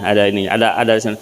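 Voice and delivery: usual speaking voice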